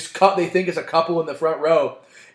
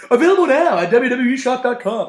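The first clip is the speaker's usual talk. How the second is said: mock ad plug; 6 semitones higher, 3 dB louder, faster than usual.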